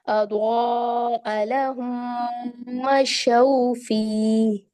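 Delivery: very slow drawn Arabic recitation ending on मशौ; Quran recitation. Shown as a demonstration. Teacher recites the next verse, very slow. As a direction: chant slowly, holding the au of मशौ. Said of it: "अगली आयत में देखें".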